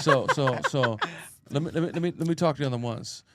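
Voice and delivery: ordinary talk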